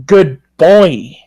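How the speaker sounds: put-on good boy with boy said as boi, a rounded oi; meme-style good boi; saying boi. Shown as a demonstration. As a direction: draw out the word boy as the meme spelling boi. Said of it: "actually, I should say it like this".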